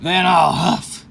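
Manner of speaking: rising to a high, loud, very drawn-out huffing threat; hammed-up villain building to a roar; big bad coyote threat. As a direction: stretch every word like a wolf winding up. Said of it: "said Coyote".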